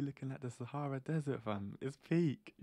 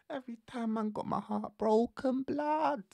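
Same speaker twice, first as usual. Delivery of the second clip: high, louder exasperated complaint; mock-anguished high outburst; 12 semitones higher, 4 dB louder than usual. Real, not acted. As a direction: cry out about the heartbreak. Recalling heartbreak.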